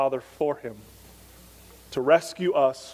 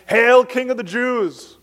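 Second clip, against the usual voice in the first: higher, louder, slow sarcastic salute; drawn-out jeering hail to a false king; mock hail; 8 semitones higher, 7 dB louder, slower than usual. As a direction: soldiers jeering at Jesus, mock royal greeting. Soldiers hail Jesus mockingly.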